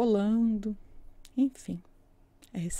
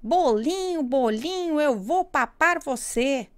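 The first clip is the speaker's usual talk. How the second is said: high, lively hare voice; 5 semitones higher, 3 dB louder than usual. Acted. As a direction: play a cheeky hungry hare. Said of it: "disse a lebre". (voz aguda de lebre)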